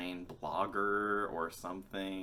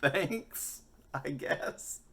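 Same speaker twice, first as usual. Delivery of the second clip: awkward thanks; 8 semitones higher, much slower than usual. Retold or imitated. Awkward reply to praise.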